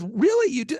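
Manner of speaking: high, loud surprised "Really?"; half-mock amazed reaction; retold surprise. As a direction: jump up in surprise at the news. Guy reacting to weekly group interviews.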